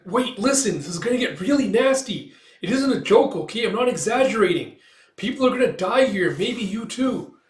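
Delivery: high, loud, insistent alarm; urgent warning. Retold or imitated.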